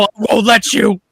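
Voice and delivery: hammed-up threat; voicing Bruce.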